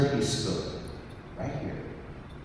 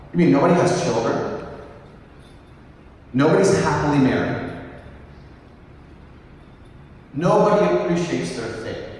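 higher and louder, feigned shock; mock astonishment; 5 semitones higher, 4 dB louder than usual. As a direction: play up the disbelief to tease them. Teasing retort to poor parish.

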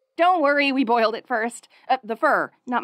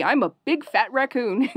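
ordinary talk

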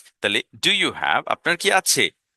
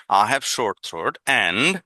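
very slow, each word drawn out for learners; teacher modelling English slowly; slow modelled question; much slower than usual. Shown as a demonstration. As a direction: spell it out slowly for the class.

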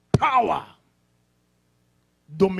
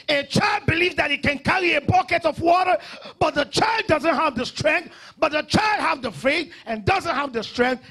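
raised, loud, driving pulpit voice; full-force preaching; 7 semitones higher, 5 dB louder than usual. Real, not acted. Pastor telling believers to grow, full-force preaching. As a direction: hammer the lesson home, high and forceful.

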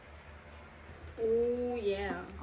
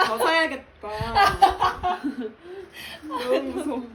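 laughing; 7 dB louder than usual. Real, not acted. Teasing about buying more.

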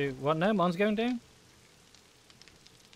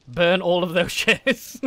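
laughing; 4 semitones higher, 7 dB louder, much slower than usual. Real, not acted. Laughing suggestion.

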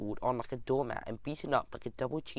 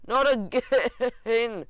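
drawn-out, higher, louder whimper; 8 semitones higher, 6 dB louder, much slower than usual. Acted. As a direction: whine it out slowly, pleading. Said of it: "I whimpered".

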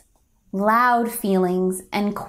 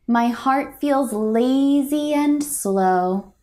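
ordinary delivery

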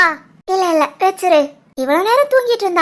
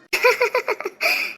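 soft laugh; 3 semitones higher, 7 dB quieter than usual. Real, not acted. Laughing at baby's silence.

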